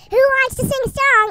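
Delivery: dad yelling. Acted. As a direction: shout it out like an excited dad. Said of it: "the dad yells".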